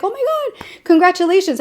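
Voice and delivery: high-pitched delighted squeal, retold; happy for him. Congratulating a boyfriend on his promotion, happy and high. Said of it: "I was so freaking happy for him".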